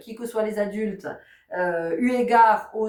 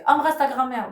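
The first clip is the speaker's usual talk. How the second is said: higher, louder firm command; insist, raising the voice; insistent parent order; 5 semitones higher, 4 dB louder than usual. Retold or imitated.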